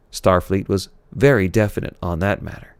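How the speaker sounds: ordinary delivery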